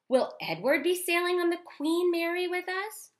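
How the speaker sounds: put-on high, loud girl's voice; little girl voice. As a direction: ask it brightly like a small girl. Child Abeline voiced in story.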